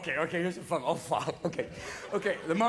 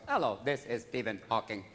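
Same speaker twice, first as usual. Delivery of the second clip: low, monotone computer voice; quiet robotic phone greeting; Stephen Hawking; 11 semitones lower, 5 dB quieter than usual. Retold or imitated. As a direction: imitate a synthesized speech machine. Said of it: "I once called Stephen Hawking's house".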